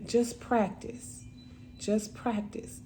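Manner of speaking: soft, slow coaxing, imitating a sister; gentle encouragement. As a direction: quietly urge a child to practice.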